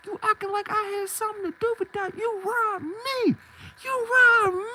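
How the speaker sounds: desperate squeaky protest; frantic high pleading. Retold or imitated. Pleading innocence to robber.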